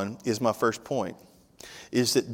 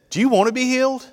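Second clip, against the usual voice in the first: higher and louder, bright and eager; 11 semitones higher, 5 dB louder, faster than usual. Shown as a demonstration. Preacher showing how Jesus really asked, eager and higher. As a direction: ask it with lively urgency, pitched up high. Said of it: "He said".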